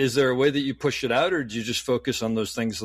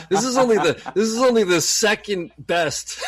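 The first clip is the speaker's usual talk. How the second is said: laughing through it, much higher and louder; laughing mock disdain; 9 semitones higher, 6 dB louder than usual. Retelling his snobbish first thought, high loud laughing. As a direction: scoff at a silver while cracking up. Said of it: "my first instinct was like".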